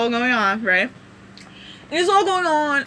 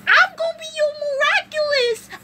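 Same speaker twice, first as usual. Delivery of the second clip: much higher little creature voice; cute plushie creature. Acted. Tiny kwami greeting Marinette in a much higher creature voice. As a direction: chirp it like a tiny magic plushie. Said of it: "the demon plushie is like".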